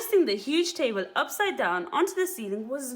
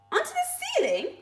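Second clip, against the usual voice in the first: much higher little monkey voice; small monkey cries out to Dad; small monkey crying out. Acted. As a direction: squeak it like a tiny doubtful child. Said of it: "cried one of the small monkeys".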